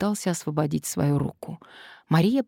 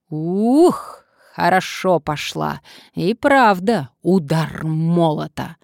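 exclaiming; 6 dB louder, slower than usual. Acted. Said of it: "и воскликнула".

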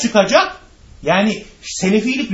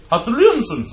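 ordinary talk, nothing marked

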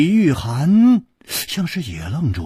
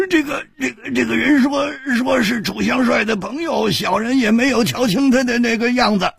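much higher, stammering plea; miserable pleading; 13 semitones higher than usual. A narrator's reading. Peddler pleading miserably with the two men, higher. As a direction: whine it out with a pained face. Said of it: "苦着脸说".

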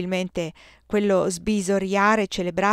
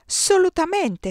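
Solutamente! esclamato molto più acuto e lento; esclamazione divertita; 12 semitones higher, 4 dB louder, slower than usual. Acted. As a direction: gridalo divertito, allungando Solutamente. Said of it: "esclamò Maurice". (Maurice riprende la parola storpiata, esclamando divertito)

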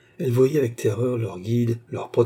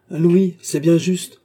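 loud, higher, drawn-out grumble; grumbling; 7 semitones higher, 7 dB louder, slower than usual. A narrator's reading. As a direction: grumble that it is hardly enough. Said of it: "grommela le cocher".

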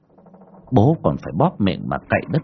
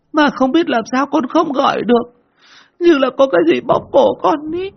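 put-on high, louder tearful child voice; whimpering, tearful; 11 semitones higher, 4 dB louder than usual. Boy tells family what he saw, tearful and high. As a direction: sob it out like a scared boy. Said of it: "anh mới mếu máo kể".

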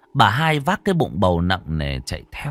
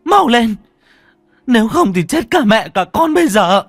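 high, urgent furious shouting, building louder; angry; 12 semitones higher, 6 dB louder than usual. A narrator's reading. First wife orders the pregnant woman carried out, angrily. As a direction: snap out frantic orders, getting louder. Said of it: "bà cả Hoa tức giận".

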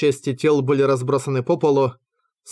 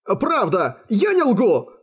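high, pleading insistence; frantic captive protesting innocence; desperate denial; 7 semitones higher, slower than usual. A narrator's reading. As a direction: plead to be believed, voice climbing. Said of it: "Отчаянное отрицание".

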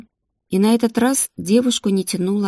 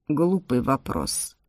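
dismissive; 6 semitones lower, 4 dB quieter, slower than usual. A narrator's reading. Shaman dismisses her question.

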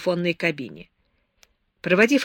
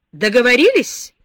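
gloating question, sharply louder; 5 semitones higher, 8 dB louder than usual. A narrator's reading. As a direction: rub her nose in it. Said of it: "злорадно спросил он". (злорадно, с торжеством)

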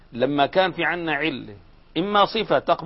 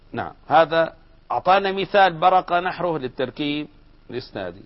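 usual speaking voice

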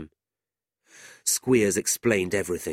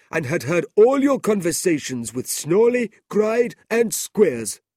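put-on high, loud and firm old gentleman; kindly merchant confronting a schemer, raised and firm; stern Brother Charles; 10 semitones higher, 7 dB louder than usual. A narrator's reading. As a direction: lay out the evidence, unshaken. Charles exposing Ralph.

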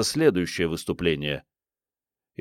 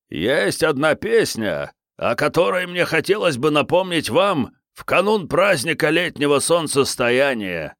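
put-on old man voice; 7 semitones higher than usual. Старик говорит из-за стойки.

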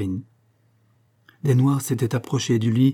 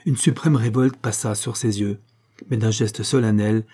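ordinary delivery, nothing marked

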